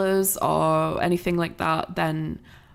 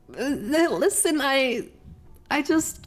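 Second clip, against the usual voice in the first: playful pleading; 7 semitones higher, slower than usual. Real, not acted.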